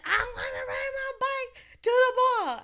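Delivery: put-on be a stubborn little boy insisting; whiny child shout. Four-year-old demanding the mall, shouted high. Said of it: "he's like".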